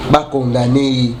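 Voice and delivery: ordinary talk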